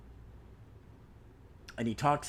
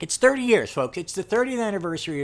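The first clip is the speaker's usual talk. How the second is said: excited; 6 semitones higher, 5 dB louder than usual. Real, not acted. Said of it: "hyping anniversary".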